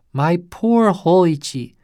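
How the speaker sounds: a priest startled at the blood; startled cry. A narrator's reading. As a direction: cry out in shocked pity. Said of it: "cried the startled priest".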